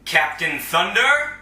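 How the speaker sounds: loud, slow call with a rising end; calling a teammate to come and help. Acted. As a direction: summon him across the room.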